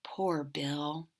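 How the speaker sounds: low pitying whisper; soft sorrowful aside; sad whisper. A narrator's reading. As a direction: breathe it out with pity for Bill. Pitying captured Bill.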